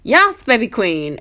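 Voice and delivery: gushing mock praise. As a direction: coo it like a doting mum. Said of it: "I must say that enough".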